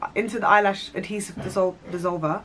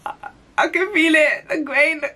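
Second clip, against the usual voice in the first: imitating crying; 8 semitones higher than usual. Telling her friends about her eye, mock tearful whimper. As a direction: act out a hammed-up tearful complaint. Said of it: "literally crying, bawling".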